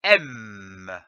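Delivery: M held out as a long hum; letter sound demo. Shown as a demonstration. Teacher pronounces the sound M for learners, sustained demo. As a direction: sustain the sound of M, letting it drop. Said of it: "Je prononce le son".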